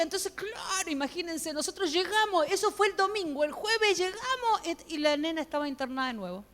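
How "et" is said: ordinary talk, nothing marked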